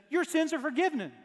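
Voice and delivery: "Your sins are forgiven" lifted high and proclaimed; proclaiming. Retold or imitated. Jesus's words proclaimed.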